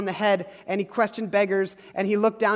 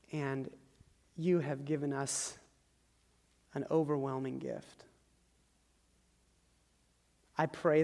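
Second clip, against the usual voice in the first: prayer. Real, not acted. Pastor prays over the congregation at the close, hushed and earnest.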